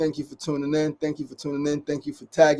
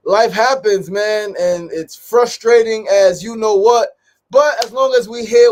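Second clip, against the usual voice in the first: emphatic; 6 semitones higher, 8 dB louder than usual. Real, not acted. Venting frustration to viewers.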